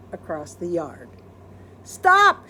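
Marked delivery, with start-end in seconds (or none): 1.8-2.5 loud high "Stop!"; panicked shout. Acted. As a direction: yell to stop the cat. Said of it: "shouted Amelia Bedelia".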